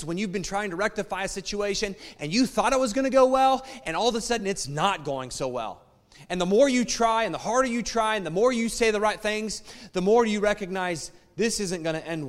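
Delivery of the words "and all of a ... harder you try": ordinary delivery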